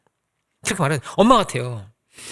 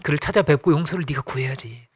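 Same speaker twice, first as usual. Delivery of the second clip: gentle instruction; 5 semitones lower, 5 dB quieter than usual. Acted. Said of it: "오네시모한테도 말하는 거예요".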